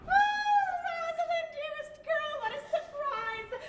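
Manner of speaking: high gushing greeting, settling as it goes; delighted surprise. Acted. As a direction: start with a high cry, then coo. Grandmother greets visiting granddaughter.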